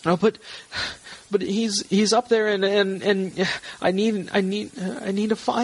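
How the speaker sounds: high, faltering protest; distressed wife. Acted. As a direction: stammer a desperate plea in a woman's voice.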